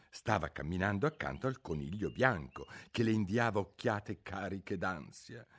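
plain reading voice

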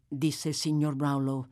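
plain reading voice

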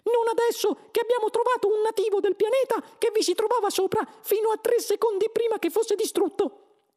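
very high, loud squeak; Frankie. A narrator's reading. Mouse announces the new Earth is unneeded, squeaky voice. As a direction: pipe it out as a mouse. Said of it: "disse il topo Frankie".

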